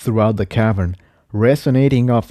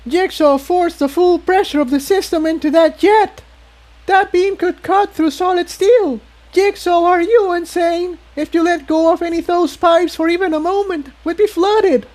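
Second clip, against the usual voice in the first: much higher, alarmed and quickening toward the end; Tiptoe's voice. A narrator's reading. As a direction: Tiptoe scolding Jigsaw, alarmed and fast.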